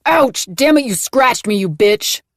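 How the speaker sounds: high, loud yelp into cursing; pained shout. Acted. Man stabbed with a pen yelling at her, loud. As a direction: yell out in sudden pain.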